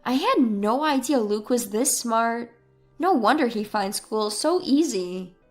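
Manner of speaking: plain reading voice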